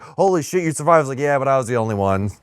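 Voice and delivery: ordinary talk, nothing marked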